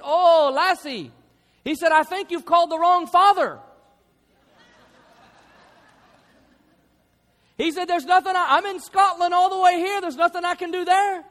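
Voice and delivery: Scottish father. Retold or imitated. Scottish father answering his son's call from Scotland. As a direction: play the Scottish father on the phone. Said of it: "like only his father could have said".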